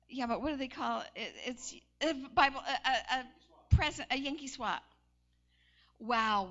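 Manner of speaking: ordinary talk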